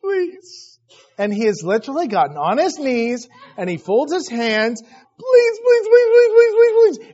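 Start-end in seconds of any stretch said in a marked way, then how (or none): 0.0-0.8 high-pitched pleading whine; mock high little plea; imitated child begging. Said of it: "And he begs". 4.8-7.1 high, loud, rapid-fire pleading; whiny, tearful pretend begging; pitiful mock begging. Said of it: "And he begs".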